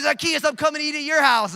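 loud, high, laughing call; hammed-up cheerful invitation; playful Jesus impression. Jesus calling to Zacchaeus, loud laughing mock call. Jesus voiced in funny retelling.

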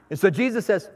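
normal talking voice

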